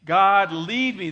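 God, lead me drawn out slow and loud; slow shouted group prayer; slow loud unison. Shown as a demonstration. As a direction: lead the crowd saying it together, slowly. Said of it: "Say it with me".

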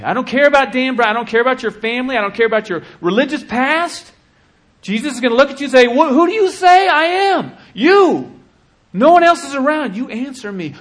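high, loud, fast shouting preacher; preacher shouting at the climax; full-force preaching. Real, not acted. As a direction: drive it home at full force.

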